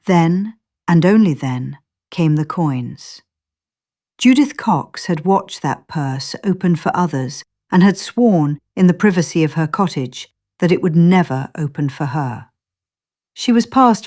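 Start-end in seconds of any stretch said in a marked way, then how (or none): none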